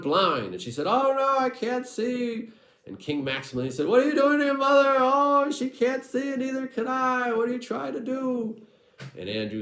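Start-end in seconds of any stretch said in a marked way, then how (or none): 0.9-2.5 high, shrill-pitched cry of a blinded mother; panicked mother. Acted. Blinded mother crying out to her family in high alarm. 3.9-8.6 very high, loud panicked royal voice; alarmed king. Acted. Blinded king shouting at his wife and son in high alarm.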